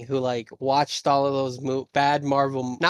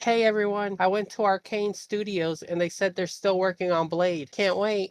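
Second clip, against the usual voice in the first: lightly voiced Todd Howard, higher and bright-eyed; a mock upbeat Todd Howard update, pitched up; Todd Howard impression; 6 semitones higher than usual. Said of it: "that's what this whole thing sounded".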